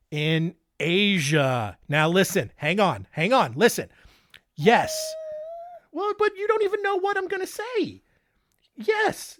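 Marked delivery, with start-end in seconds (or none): 4.6-9.4 much higher, squeaky pretend answer; hammed-up high voice talking back; mock squeaky reply. Host answers an imagined listener in a mock high voice.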